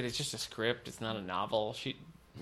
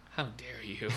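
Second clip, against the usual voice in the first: softly spoken mock outrage; mock indignation; 8 dB quieter, slower than usual. Listener to co-host over book pick, quiet mock indignation. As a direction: feign offence under your voice.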